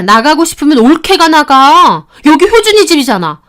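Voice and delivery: very loud, high, enraged yelling; screaming mother-in-law. Acted. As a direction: lose all control. (미친 듯 소리 지르는 시어머니)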